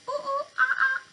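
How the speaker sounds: high, far-off monkey hoots; monkey call. Acted. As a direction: hoot it out like a playful monkey. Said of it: "And he says".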